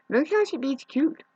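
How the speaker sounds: high, loud, delighted voice; high-pitched pet-owner excitement; excited cat gushing. Real, not acted. Excited over the cats.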